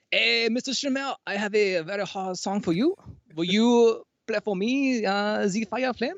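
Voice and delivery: high, hammed-up French accent, stretched slow; a pushy French fan begging for his song; French requester voice. Streamer mimics a French fan requesting Fire and Flames, high mock French accent. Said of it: "evolved into this French person".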